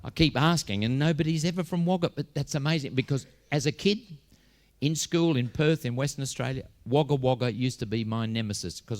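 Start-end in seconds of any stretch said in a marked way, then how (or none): none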